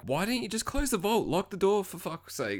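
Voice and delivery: high-pitched exasperated complaint; incredulous, high mock plea; mock exasperation. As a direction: pitch it up, pleading for common sense. Mock exasperation at film's characters.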